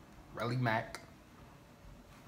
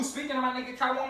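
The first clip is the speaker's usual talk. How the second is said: ordinary delivery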